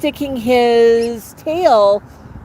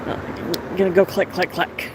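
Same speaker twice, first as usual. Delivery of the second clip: ordinary talk, nothing marked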